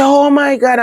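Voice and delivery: very high, loud anguished cry; scream bawl. Retold or imitated. Hearing her mother died, a high loud cry. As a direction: let the cry break out high. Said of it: "I me start scream bawl".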